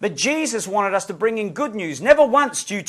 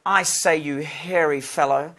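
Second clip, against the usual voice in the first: lower, softer mock-genteel voice; cleaned-up polite miner; 5 semitones lower, 5 dB quieter than usual. Saved miner politely urging donkey, mock-genteel request. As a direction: coax politely, low and measured. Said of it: "their language is getting cleaned up".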